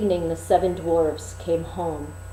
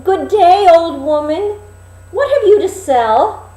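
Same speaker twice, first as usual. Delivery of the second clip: put-on high, loud, innocent girl; sweet Snowdrop at the window; young girl's voice; 10 semitones higher, 9 dB louder than usual. Snowdrop at the window greeting the old peddler, high.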